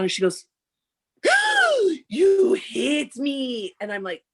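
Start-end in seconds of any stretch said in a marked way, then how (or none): 1.2-2.0 loud, high imitated gasp; hammed-up gasp of outrage; dramatic gasp. Imitates upset companion's gasp. 2.1-3.7 high, loud, drawn-out mock wail; hammed-up wounded cry; wailed accusation. Imitates companion's wailed accusation.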